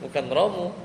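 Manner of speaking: laughing. Real, not acted.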